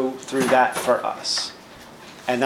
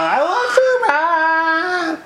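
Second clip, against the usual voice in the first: put-on very high, squeaky little child's voice; hammed-up toddler crying out for Mom; small child; 3 dB louder, much slower than usual. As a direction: squeak it out like a tiny kid calling Mom. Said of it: "That child starts crying and going".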